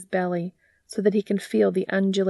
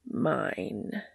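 possessive; 5 semitones lower, 6 dB quieter than usual. A narrator's reading. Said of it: "Cass says".

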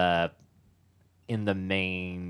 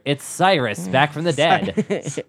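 dramatic announcement; 6 semitones higher, 6 dB louder, slower than usual. Pretend. Spoiler joke, dramatic.

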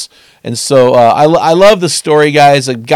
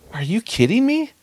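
high, soft disbelieving question; incredulous retort; 8 semitones higher, 8 dB quieter than usual. Retold or imitated. Preacher retelling his reply to the guy, incredulous.

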